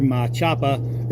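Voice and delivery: Boston accent, Machapa said with dropped r's, mah-CHAH-puh; slow, drawn-out Boston accent on Machapa. Shown as a demonstration. Naming his knife in a Boston accent. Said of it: "doesn't speak with that horrible accent".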